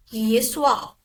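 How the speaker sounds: ordinary talk, nothing marked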